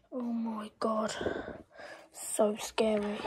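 hushed, frightened whisper; scared whisper. Real, not acted. Player whispering to viewers in fear, scared hush. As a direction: breathe the words in fear.

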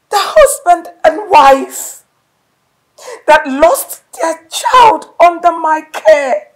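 sobbing shout. Acted. Wife screaming at husband about the lost daughter. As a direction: wail it out at him.

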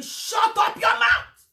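high, loud slow snap; angry retort. Retold or imitated. Speaker snapping angrily at her friend. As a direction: bark it back at her. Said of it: "I got angry and I sparked at her".

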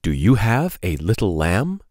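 singsong picture-book read-aloud; reading to children. Acted. Storyteller reading a lamb book to kids, singsong. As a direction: lilt the question refrain for little listeners.

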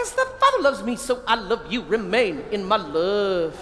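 put-on a little boy chanting it around the house; child's verse chant, stretched slow at the end; kid reciting. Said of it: "He goes around the house".

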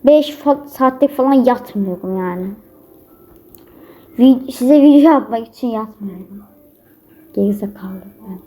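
ordinary delivery

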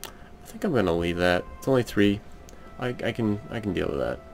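ordinary delivery

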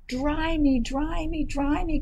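high, pleading chick voice; crying baby chick. Acted. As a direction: beg in a high little chick cry. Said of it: "cried the soggy baby chick".

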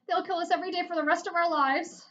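high, loud panicky plea; scared kid begging. A narrator's reading. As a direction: beg for your life. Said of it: "begged Harold".

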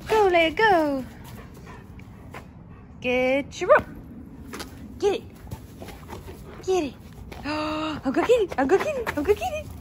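squealing play voice; playful dog hype. Real, not acted. Playing with dog.

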